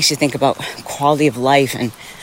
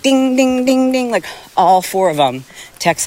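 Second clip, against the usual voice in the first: mock notification dings; 8 semitones higher than usual. Imitating phone text alerts.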